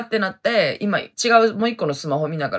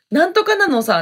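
Hokkaido dialect quote; 10 semitones higher, 4 dB louder than usual. Retold or imitated. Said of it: "って使うようになりました".

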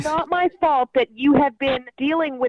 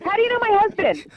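shocked; 7 semitones higher, faster than usual. Real, not acted. Shocked by the husband's name.

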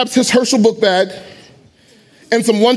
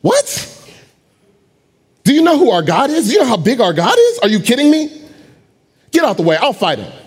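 indignant David; 5 semitones higher than usual. Acted. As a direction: shoot up in outrage. David outraged at soldiers' fear.

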